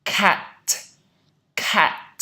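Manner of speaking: cat with a loud, wide-open A; teacher stretching cat; exaggerated cat. Shown as a demonstration. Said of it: "Từ tiếp theo".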